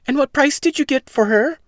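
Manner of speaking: high, loud scolding mother; worried mother. Acted. Mother complaining to Jack about the cow, high and loud.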